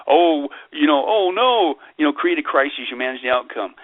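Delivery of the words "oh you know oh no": high mock-worried "oh no"; sarcastic alarm. Mocking how people react to a created crisis, sarcastic alarm. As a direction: play a panicky public, pitched up in mockery. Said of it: "I'm using sarcasm to make a point".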